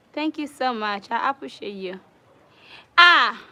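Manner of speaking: ordinary talk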